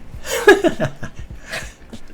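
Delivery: high breathy laugh bursting out; burst of laughter. Real, not acted. Viewer's funny name sets off a laugh. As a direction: crack up at the name.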